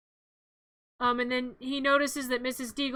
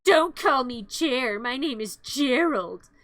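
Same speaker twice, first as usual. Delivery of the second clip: slow, drawn-out smug boast; slow self-satisfied braggart; smug Gerald; slower than usual. Retold or imitated. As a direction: sneer it out slowly like a pompous jerk. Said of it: "And Gerald's like".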